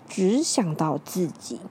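plain reading voice

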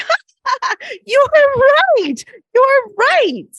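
put-on high, loud squeal of a laughing little girl; imitated child laughing. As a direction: crack up like a delighted child. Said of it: "she just starts laughing".

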